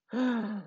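short gasp of dismay; startled gasp. Acted. Kitten hearing she may be sick, startled gasp. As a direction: catch your breath in alarm. Storyteller acts Miaomiao's dismayed reaction.

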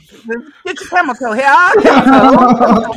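loud, high carnival barker call. Pretend. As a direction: bark it out like a fairground hawker. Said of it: "talk like a carnival barker".

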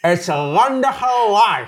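loud laughing through mouthguard; wearing a mouthguard. Real, not acted. Mouthguard game.

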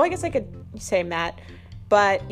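usual speaking voice

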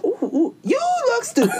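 very high-pitched mocking voice; mock squeal. Mocking a girl who just stands there, high silly squeal.

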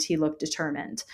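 plain reading voice

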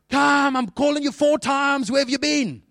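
high, loud, drawn-out parental yell; shouting mom. Acted. Mom shouting for her child to come, high loud yell. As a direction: call out like an exasperated mother. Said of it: "your mom shouting for you".

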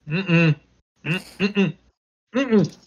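high closed-mouth hums of refusal; stubborn kid humming no with lips shut; kid refusing. Retold or imitated. To his mom, a kid hums a stubborn refusal. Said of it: "And I'm like".

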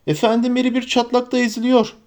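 high, pleading call; urgent cry. Acted. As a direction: beg for help, pitched high. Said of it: "diye bağırdı Sam".

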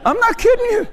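very high, squeaky amusement; tickled, voice shooting up high; laughing disbelief. Real, not acted. Still laughing at the devil's stupidity.

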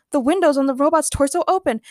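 ordinary delivery, nothing marked

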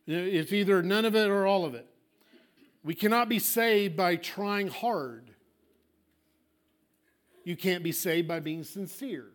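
usual speaking voice

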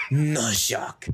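put-on tough-guy voice; terse action-star impression; Jason Statham. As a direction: attempt an action hero's voice. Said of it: "back to the Jason Statham voice".

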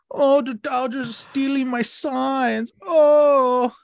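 high, whining mock of a sore loser; whiny crybaby mockery. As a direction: whine it like a salty baby, pitch up. Mocking Seth Lugo's complaint.

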